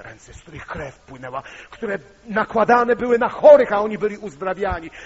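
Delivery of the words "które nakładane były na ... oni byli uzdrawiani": loud, high preaching burst; preaching at full force. Acted. Preacher to congregation, loud fervent proclamation.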